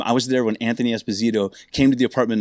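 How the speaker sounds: normal talking voice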